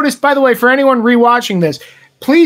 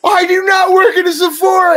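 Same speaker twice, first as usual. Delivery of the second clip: indignant lady; 8 semitones higher than usual. Retold or imitated. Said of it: "And she just goes".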